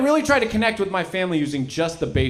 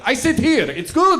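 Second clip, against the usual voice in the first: put-on high-pitched foreign-accented broken English; hammed-up foreign accent, pitched high; 12 semitones higher, 4 dB louder than usual. Ethnicking it up for his family, put-on foreign accent. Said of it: "I can't help but ethnic it up".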